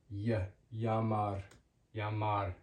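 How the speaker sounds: "y" alone, then "llamar" as yah-MAR; double L as Y. Shown as a demonstration. Teacher modelling two L's for learners, "llamar" with a Y sound. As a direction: start "llamar" on a Y glide, no L. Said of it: "This makes a Y sound".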